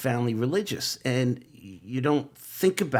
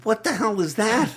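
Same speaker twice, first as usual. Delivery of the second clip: high, louder disbelief; startled exclamation; 9 semitones higher, 3 dB louder than usual. Retold or imitated. Alone in his car after the radio voice, startled and loud. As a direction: blurt it out, thrown by what you heard.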